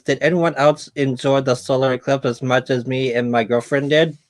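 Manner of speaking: ordinary talk, nothing marked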